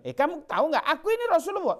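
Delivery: high, loud, boastful and indignant; mock haughty retort. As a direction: pretend to pull rank, puffed up. Mock arrogant reply Rasulullah never gave.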